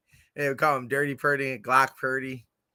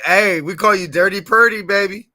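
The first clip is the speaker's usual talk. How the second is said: flirty hype; 6 semitones higher, 10 dB louder than usual. Pretend. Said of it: "and I'll say".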